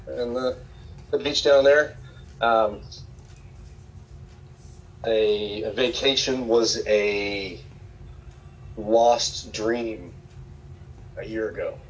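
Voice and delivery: choked up, fighting tears; holding back sobs. Real, not acted. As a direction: voice catching with emotion. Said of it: "Sorry, I get emotional".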